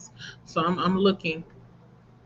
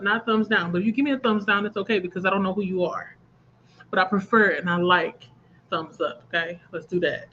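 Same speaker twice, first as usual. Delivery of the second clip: normal talking voice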